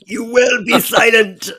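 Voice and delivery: mock booming command to noisy kids; Bortus command. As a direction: bellow the order slowly, like a warrior silencing a room. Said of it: "I want to record that little soundbite".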